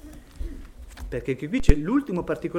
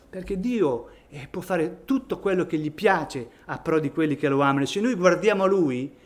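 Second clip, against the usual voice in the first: normal talking voice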